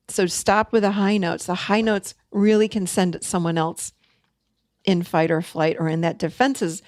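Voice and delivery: ordinary delivery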